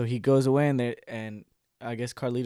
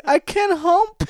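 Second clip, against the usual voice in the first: high, loud mock crying; hammed-up tearful plea; 9 dB louder, much slower than usual. Lalin begging betrayed friend Carlito, mock-tearful apology. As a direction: wail it like a pathetic whimperer.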